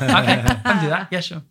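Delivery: high, loud, laughing eager reply; laughing mock eagerness. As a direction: chirp it through a laugh, keen to please. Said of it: "I'm like".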